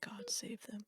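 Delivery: usual speaking voice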